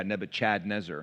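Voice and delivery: "Nebuchadnezzar" said with CHAD in the middle, as in the name; "Nebuchadnezzar" punned as Nebu-CHAD-nezzar; joking mispronunciation. Retold or imitated. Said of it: "as he likes to say".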